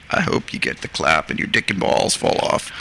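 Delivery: low, icy retold curse; cold. Local man cursing filmmaker from truck, imitated cold low voice. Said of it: "looked at me again really coldly".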